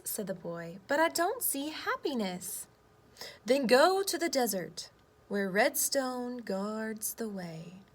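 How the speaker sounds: lively picture-book read-aloud with voiced boy and earth; reading to children. Teacher reading a story to her class, lively read-aloud.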